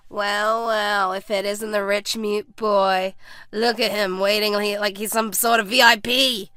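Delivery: slow, drawn-out sarcastic bully. A narrator's reading. First bully sneers at the waiting rich boy, sarcastic. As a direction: draw it out with mocking relish. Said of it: "sarcastically said".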